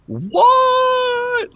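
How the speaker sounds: very high, drawn-out "what"; mimicking someone utterly amazed; amazed mock reaction. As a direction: squeal it in astonishment, stretched long. Retold amazed reaction to a wrap.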